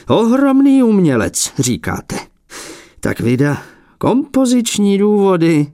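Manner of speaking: put-on a prickly old painter feigning modesty; fussy old abbot. A narrator's reading.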